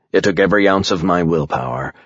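ordinary delivery, nothing marked

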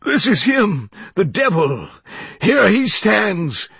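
high, loud, anguished wail; sobbing cry. A narrator's reading. Reverend denouncing the devil before his congregation, sobbing cry. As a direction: cry out desperately, stretching the last words.

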